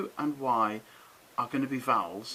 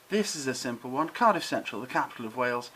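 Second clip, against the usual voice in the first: usual speaking voice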